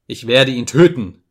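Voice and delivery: loud acted outburst; sudden cry. A narrator's reading. Narrator voices man banging the table, sudden cry. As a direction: burst out loudly with a threat. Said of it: "rief ich plötzlich".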